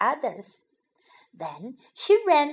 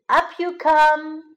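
plain reading voice

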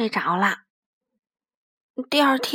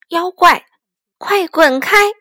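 高而响地喝斥; 像赶妖怪一样喊出书名; 喊出来的书名; 4 semitones higher, 8 dB louder, slower than usual. Acted. 念书名里的喊话. (大声喝斥)

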